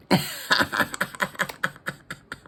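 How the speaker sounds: breathy rattling chuckle, trailing away; long staccato laugh that slowly runs out; villainous laugh. A narrator's reading. Chameleon plans to rule the world, villainous laugh. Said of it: "he just laughed and laughed".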